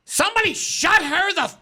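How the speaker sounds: high, loud imitation of an angry old lady; hammed-up exasperated outburst; retold fed-up yell. Fed-up lady next to her snaps.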